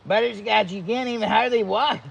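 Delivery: high playful scolding for the dog; teasing the dog. Real, not acted. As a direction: tease the fat dog playfully.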